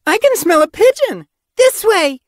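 very high, loud excited apprentice. A narrator's reading. Young apprentice catches a pigeon's scent, excited. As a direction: burst out with eager excitement, high-pitched. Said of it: "gave an excited little bounce".

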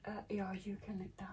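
whispering. Real, not acted. Whispered aside while filming.

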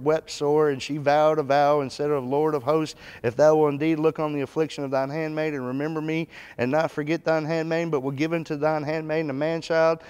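loud, high, preaching read-aloud; fervent scripture reading. Acted. Preacher reads Hannah's vow aloud, loud and fervent. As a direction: proclaim the verse to the congregation.

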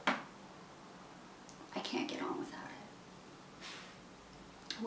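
very soft, slow whisper; hushed, voiceless admission; whispered confession. Acted. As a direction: confess it under your breath. Confessing need to write.

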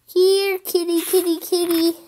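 high, repeated coaxing call; calling a cat. Acted.

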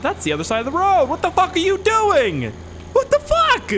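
high-pitched, loud outraged shout; real road-rage outburst; angry yelling. Real, not acted. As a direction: scream at a reckless driver. Yelling at bad driver in game.

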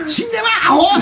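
high-pitched imitation of an Osaka curse; mock angry insult. Comedian echoing the friend's angry retort, mock outburst. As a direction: throw out a playful mock curse.

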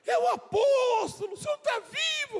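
shocked shout. Retold or imitated. Old lady on the phone, shocked the apostle is alive, high shocked shout. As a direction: shriek it as if seeing a ghost.

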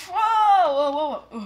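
loud high whoa tailing down; mock tumbling yell; pretend falling whoa. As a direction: cry whoa as if toppling. Toy knocked about in play.

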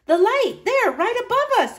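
high squeal of excitement. A narrator's reading. Girl spotting the light, high squeal. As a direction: squeal it, thrilled. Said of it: "I squealed".

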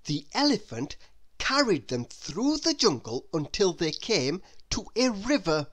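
ordinary delivery

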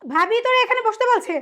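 loud, indignant questioning; angry disbelief. Acted. Woman questions man's excuse about sister-in-law, angry disbelief.

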